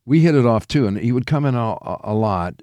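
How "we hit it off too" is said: ordinary talk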